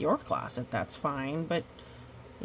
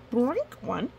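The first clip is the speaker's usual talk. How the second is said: plain reading voice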